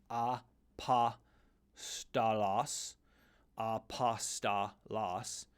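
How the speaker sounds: apostolos broken into four separate syllables, a-pa-sta-los. Shown as a demonstration. Teacher showing a four syllable word, syllable by syllable. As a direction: say apostolos slowly, one syllable at a time. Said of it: "on a four syllab-, syllable word".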